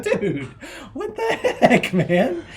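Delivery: high, drawn-out laughing disbelief; comically exasperated dad; mock exasperation. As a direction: stretch it out high, laughing in protest. Mock exasperation at son's trick.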